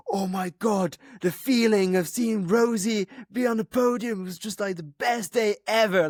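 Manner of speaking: high, gushing retold excitement; imitated thrilled teammate. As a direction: gush it like an overjoyed teammate. Retelling a teammate's thrilled reaction.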